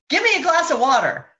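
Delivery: put-on high, loud, fast demanding child; bossy child demand. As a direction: snap an order like a bossy kid. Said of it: "when your child says".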